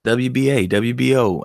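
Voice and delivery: ordinary talk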